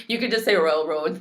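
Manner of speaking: usual speaking voice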